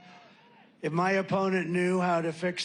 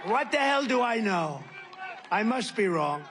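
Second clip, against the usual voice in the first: loud, high sarcastic outburst; sarcastic shout; 5 semitones higher, 4 dB louder than usual. Real, not acted. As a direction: yell it with mock humility.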